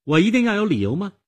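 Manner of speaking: put-on high, loud, indignant girl; biting, challenging girlish retort; cutting. A narrator's reading. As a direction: throw the questions back tartly. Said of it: "他尖刻地说".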